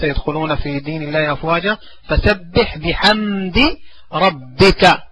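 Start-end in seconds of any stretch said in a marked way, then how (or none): 2.1-5.0 drawn-out syllables, teacher modelling; slow, careful verse reading for children; slow drilled recitation. Shown as a demonstration. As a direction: stretch each word slowly for the pupil. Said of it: "teacher models the verse slowly".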